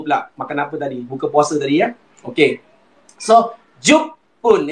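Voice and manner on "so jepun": Jepun drawn out slowly as jupun; model pronunciation. Shown as a demonstration. Teacher leads class to repeat Jepun, slow. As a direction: stretch Jepun slowly, u vowel. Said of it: "Ikut saya, Mok".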